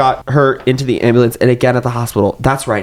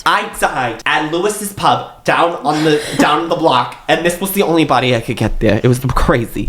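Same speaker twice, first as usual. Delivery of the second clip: Long Island Medium; 5 semitones higher than usual. Acted. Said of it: "Long Island Medium is just a ghost possessing that woman's body".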